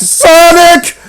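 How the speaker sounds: loud, drawn-out hype shout; hammed-up shout. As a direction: holler it like a big reveal. Comparing Mario to Sonic.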